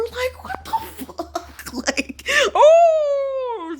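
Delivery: high squealing laugh through the words; laughing shriek. Real, not acted. Cracking up mid rant about wanting to be poor, laughing shriek.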